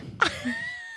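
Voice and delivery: a sudden high laugh at herself; high giggle breaking out; high burst of laughter. Real, not acted. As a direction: laugh out at the slip. Laughs at own accent slip.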